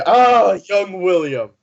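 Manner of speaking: slow, high-swooping delighted hail; hearty greeting. Acted. Morin greets young William after a laugh, hearty drawn-out hail.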